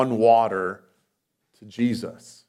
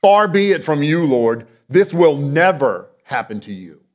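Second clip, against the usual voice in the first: rebuking; 2 semitones higher, 7 dB louder than usual. Retold or imitated. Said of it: "Peter says".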